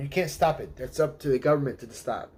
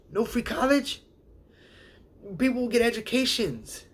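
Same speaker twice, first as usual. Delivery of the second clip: mock disbelief; 8 semitones higher than usual. Mocking disbelieving people.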